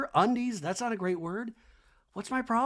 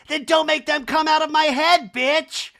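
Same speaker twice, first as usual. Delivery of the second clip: high, loud mock fury; hammed-up high angry retort; Kyle; 10 semitones higher, 8 dB louder than usual. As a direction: yell it as an exaggerated impression. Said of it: "And Kyle's like".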